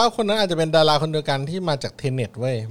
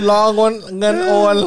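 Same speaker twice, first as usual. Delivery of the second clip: fast hype live seller; 4 semitones higher, 5 dB louder than usual. Pretend. Mock live-selling for donations.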